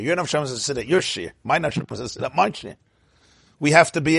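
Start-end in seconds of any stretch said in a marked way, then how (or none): 1.4-2.8 quick, softer, lightly voiced listener; a hint of someone talking about their own soul; voicing the listener. Rabbi voicing a listener's inner part, light quick voice.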